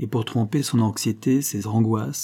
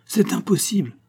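much higher disbelief; incredulous; 10 semitones higher than usual. Acted. Don José protests to his valet in disbelief. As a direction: refuse to believe it.

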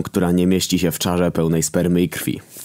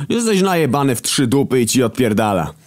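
much higher yelled insult; 8 semitones higher than usual. A narrator's reading. Hunger still yelling at drunk Death. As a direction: keep shouting at him.

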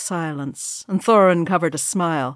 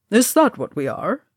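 put-on voice, gently surprised question; understated, lilting astonishment; mild surprise; 3 semitones higher than usual. A narrator's reading. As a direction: ask it with a touch of surprise. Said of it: "looking at Gimli with some surprise".

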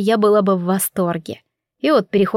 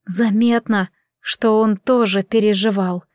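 ordinary delivery, nothing marked

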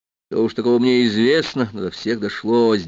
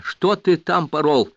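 high, drawn-out plaintive reproach; moaning; 6 semitones higher, slower than usual. A narrator's reading. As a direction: moan the question slowly at him. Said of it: "простонала моя первая комсомольская жена". (протяжный стонущий упрёк)

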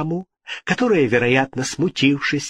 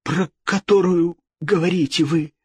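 higher-pitched puzzled question; 6 semitones higher than usual. A narrator's reading. King asking the ghost whom it means, puzzled. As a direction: ask it high, at a loss. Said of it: "спросил озадаченный король".

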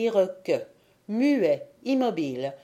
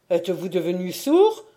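louder, impatient question; raising his voice; 4 dB louder, faster than usual. Acted. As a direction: call up to him, louder. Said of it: "ajouta-t-il en élevant la voix".

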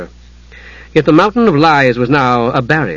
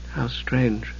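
muttering; 12 dB quieter than usual. A narrator's reading. Usha alone, puzzling over Palin's visit, muttering. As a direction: murmur it privately, very soft. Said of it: "she muttered".

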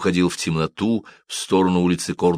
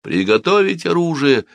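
high-pitched military command; shouted order; 9 semitones higher than usual. A narrator's reading. Guards readying their weapons, shouting. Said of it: "закричали стражники".

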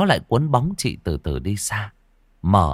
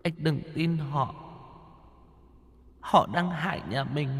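soft, high wailing ghost, rising higher; eerie ghostly voice; 8 semitones higher, 7 dB quieter than usual. A narrator's reading. Dead wife warning her husband from afar, eerie wail. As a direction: plead faintly like a drifting spirit. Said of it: "giọng nói âm u sâu thẳm của chị".

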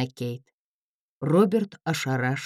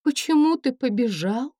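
confused; 10 semitones higher than usual. Acted. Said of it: "смущённо спросила она".

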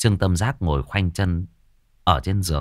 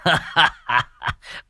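put-on old man chuckle; 7 semitones higher than usual. Old man laughs at door.

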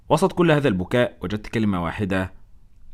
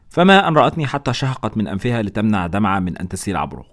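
usual speaking voice

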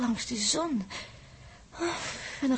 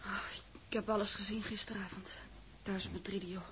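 shaken whisper; 3 semitones lower, 8 dB quieter than usual. Acted. Shaken after witnessing the disaster.

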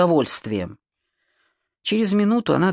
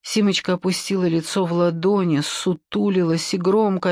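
plain reading voice

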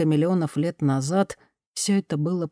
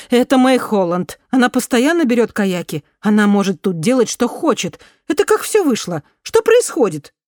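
higher and louder, rising in alarm; agitated protest; 6 semitones higher, 7 dB louder than usual. A narrator's reading. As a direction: defend her friend in loud disbelief. Marion protests to the police.

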